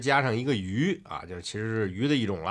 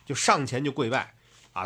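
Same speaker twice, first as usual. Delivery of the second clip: ordinary delivery, nothing marked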